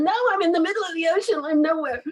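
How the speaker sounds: high, loud, quick comic outburst; mock exasperated protest. Replying to people guessing her nationality, mock exasperated. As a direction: protest it loudly, playing up the despair.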